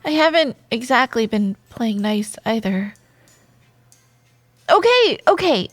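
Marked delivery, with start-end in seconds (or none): 4.7-5.7 high, loud protest; loud, exasperated giving in; defensive concession. Acted. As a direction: cut them off, caught out. Cutting off a counter-accusation.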